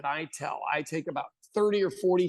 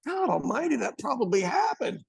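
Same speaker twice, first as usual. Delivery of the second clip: shocked audience; 7 semitones higher than usual. Acted. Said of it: "to process and go".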